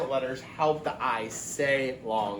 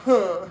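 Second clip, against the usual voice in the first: huh, a higher, louder sigh of relief; relieved sigh; 4 semitones higher, 5 dB louder than usual. Shown as a demonstration. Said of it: "Sigh is like when you're like".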